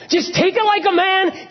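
very high, strained urging; emphatic exhortation. Retold or imitated. Urging a pastor friend to endure his wife, emphatic. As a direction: plead it forcefully, pitch way up.

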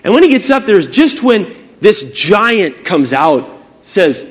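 usual speaking voice

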